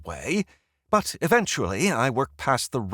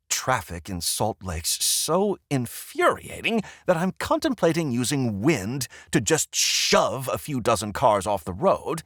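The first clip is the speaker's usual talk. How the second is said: ordinary delivery, nothing marked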